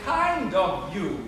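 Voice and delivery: slow, low KIND OF YOU, words kept apart; corrective pronunciation. Shown as a demonstration. Professor corrects his pupil, slow deliberate words. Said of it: "No, no".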